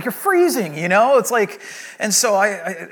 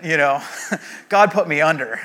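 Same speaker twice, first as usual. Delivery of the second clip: usual speaking voice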